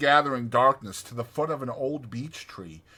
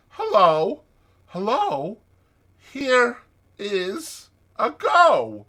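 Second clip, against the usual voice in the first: ordinary delivery, nothing marked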